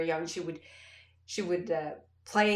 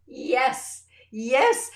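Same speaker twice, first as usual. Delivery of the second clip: excited yes; 5 semitones higher, 9 dB louder than usual. Retold or imitated.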